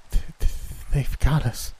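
high, stammering through chattering shivers; shivering. A narrator's reading. Freezing girl telling friends they are caught, stammering and shivering. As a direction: stutter the first word from the cold.

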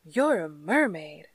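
loud astonished cry. A narrator's reading. Half-drowned man realizing the truth, a loud astonished cry. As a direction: blurt out the discovery in shock. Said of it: "he gurgled".

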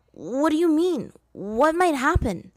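put-on high boyish worried asking; young Aang. A narrator's reading. Aang questioning Roku, high and anxious. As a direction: pitch it up like an anxious kid.

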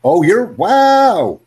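long, high, loud wow; genuinely amazed, drawn-out exclamation; impressed. Real, not acted. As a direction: stretch out an amazed wow, swooping up loudly. Impressed by student's answer.

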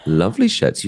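squeaky high teasing echo; high mock echo. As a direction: parrot it back in a high, teasing voice.

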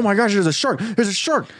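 high, panicked mock alarm; panicked shout. Panicking at the shark, hammed-up high cry.

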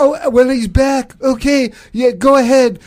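loud, blustery presidential impression; Donald Trump. President back on the phone taking questions, loud blustering impression. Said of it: "keep Don on the phone".

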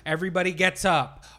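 ordinary talk

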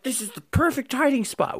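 put-on high, proud little kid voice; smug kid voice. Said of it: "It's like".

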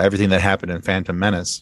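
normal talking voice

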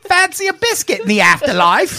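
high mock posh British; British accent. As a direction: offer it like a tea-time Brit. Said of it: "weird British accent".

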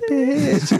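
squeaky high sass; high sassy quip. Retold or imitated. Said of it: "and I said".

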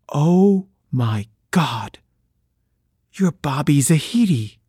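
drawn-out, slow dawning realisation; slow amazed recognition. Retold or imitated. Suzanne realising her date is her classmate, slow amazement. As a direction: let the recognition dawn slowly. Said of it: "She said".

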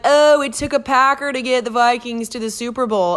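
mocking rival fans. Imitating rival Packer fans' taunt.

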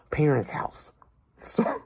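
whispered aside, a little embarrassed; sheepish whisper. Real, not acted. Admitting to viewers he still lives at home, whispered. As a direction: drop to a hushed confession.

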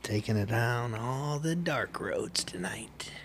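breathy spooky whisper. Pretend. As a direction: hush it like a ghost story.

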